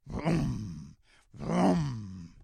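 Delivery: put-on playful engine roar for kids; engine vroom. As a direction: rev it up like a truck engine. Engine sound imitated in story.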